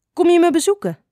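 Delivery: high, loud, eager call; eager question. A narrator's reading. Doeltje greets Klein Addertje at the beek, eager. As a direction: pipe up high, hoping for a visit.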